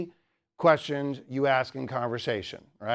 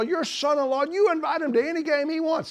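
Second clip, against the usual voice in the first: eager banker; 12 semitones higher than usual. Retold or imitated. Banker praising him to father-in-law.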